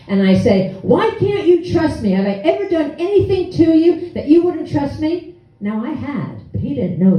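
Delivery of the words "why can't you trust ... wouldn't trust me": mock yell. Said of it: "I yell back at him at the same decibel".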